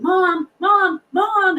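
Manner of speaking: imitating kids yelling, high and loud; kids calling out. Kids in the back seat alarm mom as the car slides, high loud calls. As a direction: call out in alarm like scared kids. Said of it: "I hear my kids in the back going".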